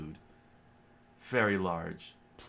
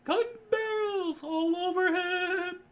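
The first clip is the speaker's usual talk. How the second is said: very high, loud squealing alarm; high falsetto cry; 6 dB louder than usual. A narrator's reading. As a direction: shriek the warning in falsetto. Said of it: "Sanji said".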